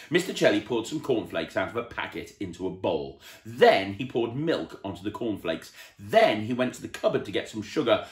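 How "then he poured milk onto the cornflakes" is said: ordinary delivery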